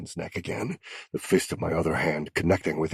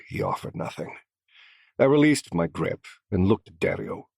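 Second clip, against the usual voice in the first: plain reading voice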